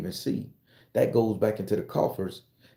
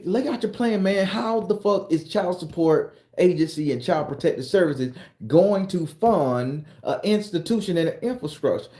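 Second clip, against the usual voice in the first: higher demanding voice; 7 semitones higher than usual. Acted. Voiced questioners demanding plan.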